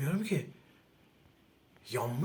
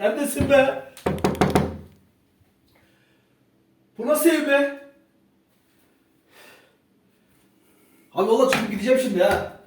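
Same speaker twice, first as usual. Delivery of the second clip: higher, louder scared yelling; shouting; 11 semitones higher, 11 dB louder than usual. Real, not acted. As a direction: yell out in fear. Frightened, shouting in the house.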